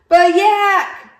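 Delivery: very high, loud cry. Acted. Walrus cries out to interrupt Yak, high and loud. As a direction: cry out to stop him. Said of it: "cried Walrus".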